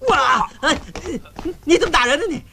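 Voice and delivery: high, startled protest; indignant yelp. Acted. Groom protesting at being hit, indignant. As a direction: yelp it as if just struck.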